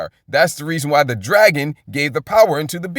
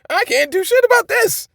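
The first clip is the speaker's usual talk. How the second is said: much higher-pitched acted protest; emphatic statement; 14 semitones higher than usual. Shown as a demonstration. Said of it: "it's an emphatic statement".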